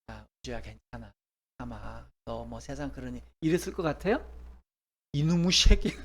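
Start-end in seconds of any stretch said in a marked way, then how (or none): 0.1-3.2 softer, a lightly voiced detached husband; offhand soothing. Husband shrugging off his upset girlfriend, soft and offhand. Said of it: "엘가나 같이". 5.1-5.9 louder, spitting out the curse; furious curse. Acted. Boyfriend cursing the bus driver, loud and furious. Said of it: "이러면서".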